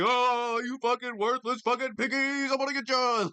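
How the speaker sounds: mock crazed man raving at cops; mocking impression. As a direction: squeak his threats out in a silly high voice. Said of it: "And he's just like".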